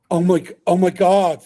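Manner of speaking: high, loud gasping fan; mock starstruck fan. A fan spotting Big D, mock starstruck gasp. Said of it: "somebody come up and go".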